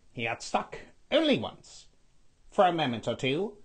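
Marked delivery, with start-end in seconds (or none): none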